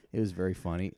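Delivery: ordinary talk, nothing marked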